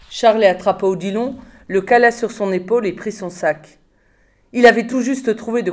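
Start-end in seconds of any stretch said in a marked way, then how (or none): none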